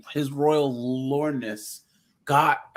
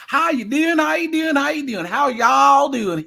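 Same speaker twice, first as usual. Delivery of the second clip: mock Southern accent; 11 semitones higher, 7 dB louder than usual. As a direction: ham up a dumb Southern accent greeting. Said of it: "Southern accents to us make people sound dumb".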